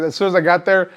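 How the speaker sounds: usual speaking voice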